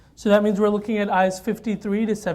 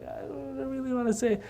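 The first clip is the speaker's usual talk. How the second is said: reluctant mumble; 3 semitones higher, 11 dB quieter than usual. Retold or imitated. Teacher reluctant, retold.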